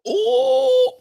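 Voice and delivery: high delighted ooh; impressed ooh. Real, not acted. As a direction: squeal at the taste. Tasting the strong drink.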